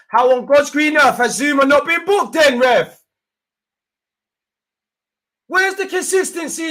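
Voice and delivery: high, loud shouting rant at the ref; furious rant. Real, not acted. Fan raging at the ref over Zouma's missing booking, high furious shouting. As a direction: rail at the referee at full pitch.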